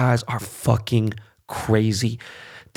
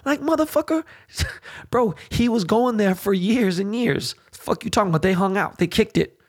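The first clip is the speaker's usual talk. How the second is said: much higher, worked-up disbelief with a laugh; can't believe what people are saying; fired-up, high-pitched rant; incredulous outburst; 9 semitones higher than usual. Real, not acted. Incredulous rant about Trump and Epstein.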